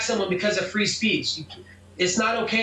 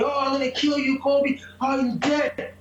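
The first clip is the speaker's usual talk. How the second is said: higher-pitched imitation of a yelled threat; mock yelling; 5 semitones higher than usual. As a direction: mimic an attacker yelling, pitched well up. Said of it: "Fabricio's still yelling at me".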